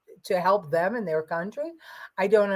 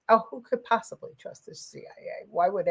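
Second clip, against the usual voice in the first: sarcastic, mocking question; 6 dB quieter than usual. Real, not acted. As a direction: scoff at the idea. Mocking a chat comment.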